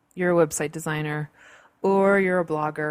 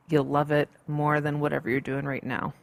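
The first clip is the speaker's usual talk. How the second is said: normal talking voice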